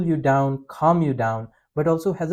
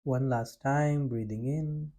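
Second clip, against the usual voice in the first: guided breathing; 3 semitones lower, 7 dB quieter than usual. Acted. Leads a breathing practice.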